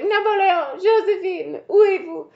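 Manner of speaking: high, wailing French lady voice; French lady calling. Retold or imitated. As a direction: call out high and theatrical, mock-French. Mocking French lady calling her dog.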